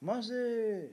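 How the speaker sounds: high, drawn-out wail to a father; plaintive acted howl; mock wailing cry. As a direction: imitate a wronged son crying out, stretching the last word. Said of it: "צועק".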